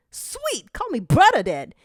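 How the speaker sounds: high, squeaky delight; excited character voice. Acted. Weasel Bandit accepting the brotherhood, high and thrilled. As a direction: burst out happy and high.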